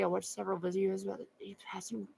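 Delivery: fast whisper reading a comment to herself. Real, not acted. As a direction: murmur it half to yourself, quickly. Reading comment under breath.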